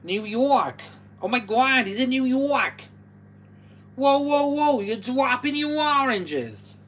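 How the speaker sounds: loud, high-pitched hammed-up New York accent. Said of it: "that's how I talk".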